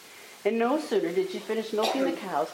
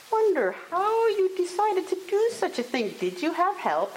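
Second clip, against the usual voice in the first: raised, high-pitched wondering question; probing old mistress; 11 semitones higher, 4 dB louder than usual. A narrator's reading. Mother Holla asking the girl about help, high and probing. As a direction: quiz the girl in a high, loud storybook voice.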